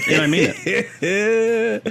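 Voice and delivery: laughing. Real, not acted. Deadpan troll answer, laughing.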